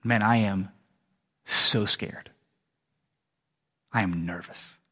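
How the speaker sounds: understated nervous confession, low and hushed; scared man. Acted. One man confiding in another before the night of judgment, mildly scared. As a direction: act a frightened man quietly, halting between phrases. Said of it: "one of the men looks at the other guy and says".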